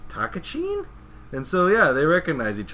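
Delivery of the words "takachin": very high rising question; surprised recognition. Retold or imitated. Shinpachi recognizing his old friend, surprised high rise. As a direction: shoot the name way up in surprise. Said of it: "And Shinpachi goes".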